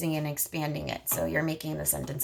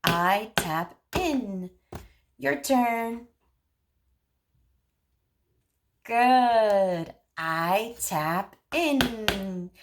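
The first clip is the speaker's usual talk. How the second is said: each word drawn out slowly; very slow modeling; 2 semitones higher, much slower than usual. Shown as a demonstration. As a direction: stretch every word for a child. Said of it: "You could say".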